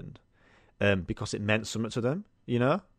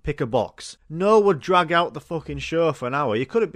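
normal talking voice

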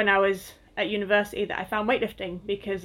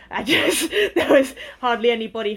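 laughing; 3 semitones higher, 5 dB louder than usual. Real, not acted. Laughs about being so muscly.

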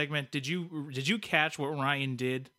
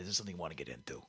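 a light imitation of a cigar-chomping fat cat, low and fast; mock the fat-cat sales pitch, rushed and low; music business fat cat; 10 semitones lower, 11 dB quieter, much faster than usual. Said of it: "You're almost kinda like music business fat cat".

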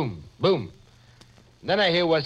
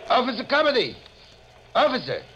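calling out; 8 semitones higher than usual. Acted. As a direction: hail the cop across the street. Calling the cop over.

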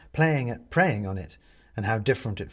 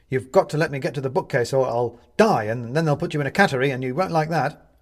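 urgent pleading; 3 semitones higher, 6 dB louder than usual. Acted.

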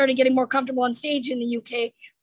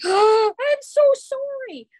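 viel höher und lauter, langsam entsetzt; gasp, then apologize like a horrified parent; mortified parent; 11 semitones higher, 5 dB louder, slower than usual. Retold or imitated. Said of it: "the look on some parents' faces are like".

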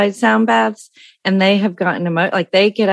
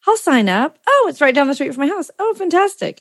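high, bright mock delight at finding the class; imitating her own eager reaction, pitched up; excited; 7 semitones higher than usual. Retelling her discovery to the teacher, excited.

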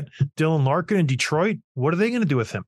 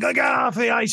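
mock angry yell; 8 semitones higher than usual. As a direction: bark it like a furious player. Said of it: "he's like".

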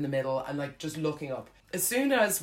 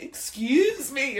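drawn-out, high, loud offended protest; acted affront, pitched sharply up; indignant; 10 semitones higher, 5 dB louder, much slower than usual. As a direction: turn on a rude stranger, stretching the words. Indignant turn after being thumped.

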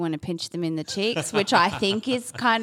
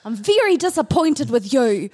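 a laughing, higher Kiwi scolding that sounds like a question; mock New Zealand accent; 6 semitones higher, 9 dB louder than usual. Imitating mum's Kiwi partner yelling at the kids, in a New Zealand accent. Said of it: "spent many a year impersonating the accent".

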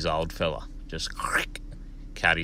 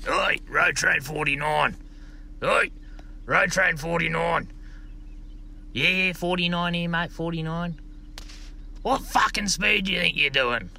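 cranky mine manager on the radio; 12 semitones higher than usual. Retold or imitated. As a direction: bark it down the radio like a dry old manager. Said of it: "He's straight on the two-way".

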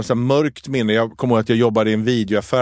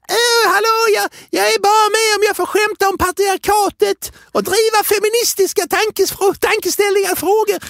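much higher, prissy mimic of herself; mocking high voice. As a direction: parody a preachy feminist in falsetto. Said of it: "Det var så här". (hög, hånfull parodiröst)

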